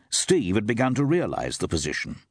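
ordinary delivery, nothing marked

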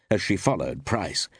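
plain reading voice

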